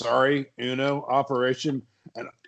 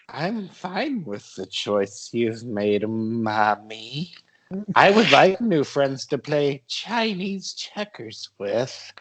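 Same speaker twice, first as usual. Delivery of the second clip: put-on slow, odd little-boy voice; childlike Todd; slower than usual. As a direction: talk like a strange slow kid.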